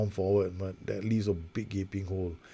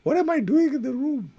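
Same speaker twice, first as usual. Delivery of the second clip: wife; 8 dB louder than usual. Retold or imitated. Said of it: "My wife is like".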